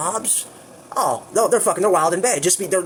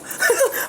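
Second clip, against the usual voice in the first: laugh. Real, not acted. Laughs at the memory.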